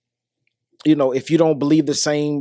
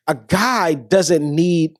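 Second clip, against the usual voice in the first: slow, each word weighed; slow emphasis; much slower than usual. Acted. To viewers about men's money, slow weighty emphasis. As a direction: lay it down slowly, word by word.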